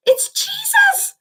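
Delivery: very high, slow joyful cry. Acted. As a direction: cry out with delight. Angel recognizes Jesus.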